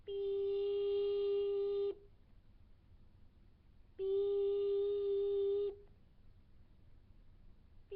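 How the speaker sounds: a high sustained robotic "beep"; beep noise. Shown as a demonstration. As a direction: hold a high electronic beep tone. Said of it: "so I'm just gonna make random noises".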